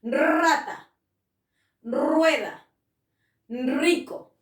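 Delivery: rata, rueda, rico each with a rolled opening r; strong initial r. Shown as a demonstration. Teacher lists words with a trilled initial r. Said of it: "suena fuerte".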